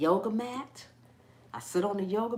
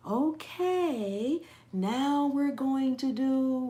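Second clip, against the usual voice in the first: mocking slow, stretched yoga-teacher voice; calm yoga instructor; 4 semitones higher, much slower than usual. Imitating yoga teachers calling downward dog, exaggerated slow calm. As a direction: draw every word out long, like an over-soothing yoga teacher. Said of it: "they talk like that".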